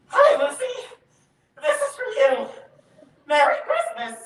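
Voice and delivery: nervous freeze. Real, not acted. Boy finally handing the girl a valentine, panicky nervous blurt.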